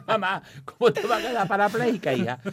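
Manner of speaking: can't keep a straight face at mom's blunder; laughing while correcting mom; laughing in disbelief. Real, not acted. Laughing at mother's malapropism.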